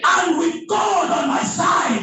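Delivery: loud, high proclamation; shouted declaration. Shown as a demonstration. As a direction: proclaim it at full voice. Preacher leads shouted declaration.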